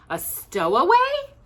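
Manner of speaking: high, loud, slow echoed question; shocked man repeats it; alarmed. Acted. Alarmed echo.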